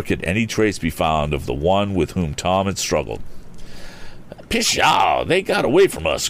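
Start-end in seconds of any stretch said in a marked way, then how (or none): 4.5-6.3 high, vexed exclamation; regretful cry. A narrator's reading. Mister Damon sees thieves escape, high regretful cry. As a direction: cry it out in disappointment, pitch up. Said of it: "cried Mister Damon regretfully".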